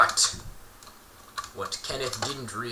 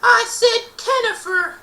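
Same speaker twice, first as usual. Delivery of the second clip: much higher, louder bully's jeer; squeaky taunt; 8 dB louder than usual. Acted. Justin repeats the nickname in a squeaky jeer. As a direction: spit the nickname back mockingly.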